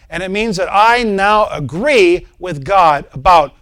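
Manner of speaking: loud, driving pulpit preaching; preaching at full force. Acted. Preacher urging his congregation to confess, full-force preaching. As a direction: hammer the point home to the congregation.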